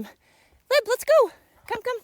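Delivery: very high, loud summons; calling out. Real, not acted. [name] calling Lib to come along, high loud call.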